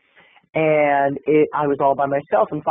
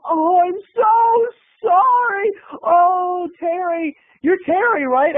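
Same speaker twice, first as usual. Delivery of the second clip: very high, gushing apology; hammed-up apology; 14 semitones higher, 3 dB louder, much slower than usual. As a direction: apologise with exaggerated, high-pitched dismay. Martin Sheen apologises after hitting her.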